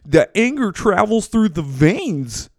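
high, mocking imagined viewer; mock critic. Imagined viewer ridiculing his veins analogy, high and mocking. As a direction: sneer it back as a scoffing critic. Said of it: "don't fucking sit here and be like".

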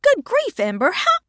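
shrill, loud good grief; mother startled by her daughter. A narrator's reading. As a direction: jump with surprise. Child suddenly beside her.

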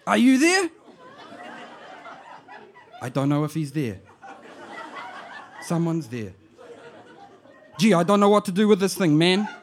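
put-on high, loud Māori auntie calling out to her boy, amused; auntie on the answer phone. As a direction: shout down the phone like a bewildered old auntie.

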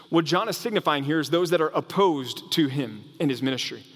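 ordinary talk

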